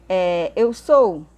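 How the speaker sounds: slow down to find the right words; slow, careful translation. Shown as a demonstration. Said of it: "teacher translates slowly".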